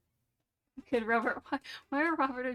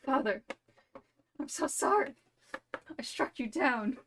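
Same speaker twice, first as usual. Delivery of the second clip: mock grief; 4 semitones higher than usual. Son mourns father he struck.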